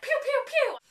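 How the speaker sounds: high, loud pew pew sound effects; mock gun noises. Recapping the professor firing his pistol, playful gun sounds. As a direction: fire off cartoon gunshots with your mouth.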